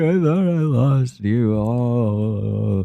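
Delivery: high, slow, drawn-out wail; hammed-up bawling kid; mock crying. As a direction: blubber it out like a child imitating tears. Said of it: "like, crying".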